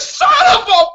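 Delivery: very high, loud outburst; comically exasperated shriek; mock furious cursing. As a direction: replay an outraged curse at full pitch. Re-enacting reaction to a spoiler text.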